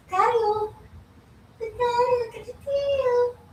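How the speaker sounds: squealy high thrill at the wagging tail; excited pet talk. Real, not acted. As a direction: cheer the dog on about its tail. Excited pointing out dog's tail.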